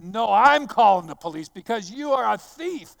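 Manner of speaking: loud, heated comeback at a cheating attendant; retold yell. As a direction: throw the threat back in his face, yelling. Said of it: "I'm yelling at this guy".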